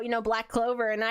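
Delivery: ordinary talk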